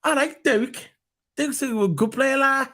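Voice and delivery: high-pitched mock Scouse fan; Scouser accent. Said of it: "imagine the Scousers saying it".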